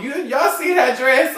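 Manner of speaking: loud scandalized question; outraged. Real, not acted. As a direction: call out the ugly dress. Outraged at the dress.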